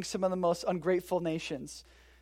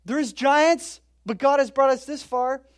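excited spies; 5 semitones higher, 4 dB louder than usual. Retold or imitated. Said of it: "throwing them on the ground like".

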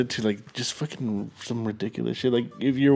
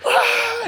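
mock muffled yell; 5 dB louder than usual. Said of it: "like, a muffled yell".

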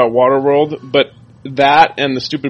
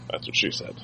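whispering; 16 dB quieter than usual. Real, not acted. Whispered joke aside.

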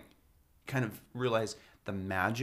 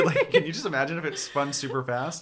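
laughing; 5 semitones higher, 6 dB louder than usual. Real, not acted. Laughing on spinning ride.

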